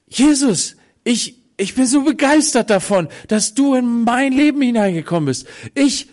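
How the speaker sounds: overjoyed. Acted. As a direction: gush out your thanks to Jesus. Zachäus speaks excitedly to Jesus.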